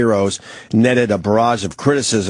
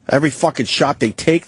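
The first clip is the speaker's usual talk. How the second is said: ordinary talk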